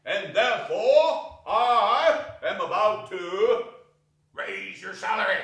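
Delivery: mock stern build-up. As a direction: hold the sternness, then spring the surprise. Scrooge's mock scolding turns generous.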